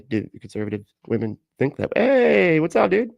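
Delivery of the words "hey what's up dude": high, loud put-on greeting; mock dude greeting. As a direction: call out a goofy high-pitched hello.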